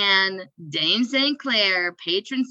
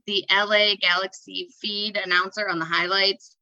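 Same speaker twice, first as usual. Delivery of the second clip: ordinary talk, nothing marked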